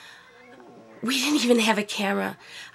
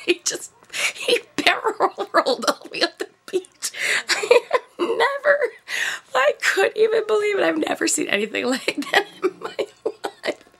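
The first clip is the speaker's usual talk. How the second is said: laughing; 10 semitones higher than usual. Real, not acted. Laughing at husband rolling.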